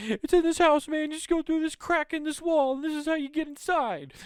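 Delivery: very high, tiny squeaky fly voice. Retold or imitated. Fly telling the spider how to get in, very high and squeaky. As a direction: squeak it out like a little bug. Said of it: "And it's like".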